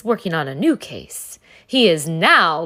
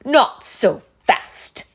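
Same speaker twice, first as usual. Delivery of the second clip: unpleasant hiss; 6 semitones higher, 6 dB louder, slower than usual. Acted. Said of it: "hissed an unpleasant voice".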